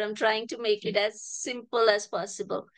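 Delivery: ordinary talk, nothing marked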